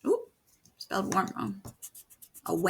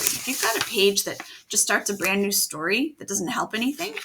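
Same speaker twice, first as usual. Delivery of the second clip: usual speaking voice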